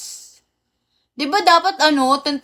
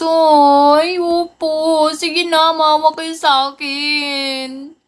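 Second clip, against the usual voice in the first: higher, drawn-out begging whine; playful pleading; 4 semitones higher, 3 dB louder, much slower than usual. Pretend. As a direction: plead for pity, stretching the last words. Nagmamakaawa ng piso sa viewers. (pabirong pagmamakaawa, pahaba ang dulo)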